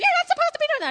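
high, loud yelling daughter; mock yell. Daughter yelling at dad from the door, loud mock scold. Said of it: "Sherry starts yelling".

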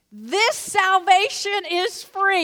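high, loud and slow proclamation; emphatic preaching. Acted. Pastor proclaiming to the church, high, loud, slow. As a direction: ring it out slowly to the room.

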